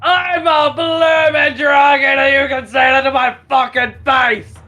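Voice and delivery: put-on loud, slurred drunken roar; drunk dragon yell. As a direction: bellow it like a plastered brute. Drunk dragonborn yelling from hall.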